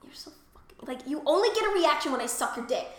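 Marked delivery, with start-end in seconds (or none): 0.0-0.9 hushed teasing whisper; conspiratorial whisper. Retold or imitated. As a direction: lean in and whisper it.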